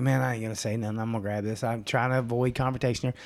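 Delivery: low, soft note to self; muttered self-talk. Retold or imitated. Husband to himself, walking in avoiding confrontation, muttered. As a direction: keep it down, talking yourself out of a fight.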